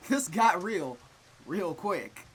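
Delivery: slow, loud laughing repeat; laughing disbelief. Real, not acted. Laughing at dark turn.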